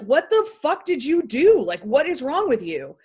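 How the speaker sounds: high, loud, alarmed yelling; shocked yell. Retold or imitated. Sister yells at her injured brother in shock. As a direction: blurt it out in horror.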